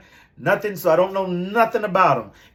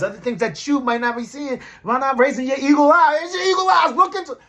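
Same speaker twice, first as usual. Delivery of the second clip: hyped urging; 9 semitones higher than usual. Real, not acted. Hyped urging eagle eye.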